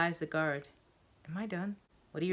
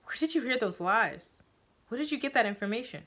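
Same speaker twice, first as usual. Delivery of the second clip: sudden louder, higher angry demand; accusing outburst, faster at the end; 6 semitones higher, 6 dB louder than usual. Acted. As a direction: snap from soft into anger. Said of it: "Her soft sounds turn to anger".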